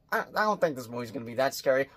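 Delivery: quick, low, soft aside; understated retold doubt. Reviewer recalling his thoughts before the film, quiet doubt. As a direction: play back your doubt, hushed and hurried. Voicing own earlier doubt.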